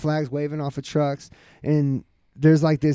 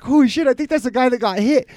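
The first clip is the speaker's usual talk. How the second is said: shocked; 8 semitones higher, 4 dB louder than usual. Retold or imitated. Retelling own shocked reaction.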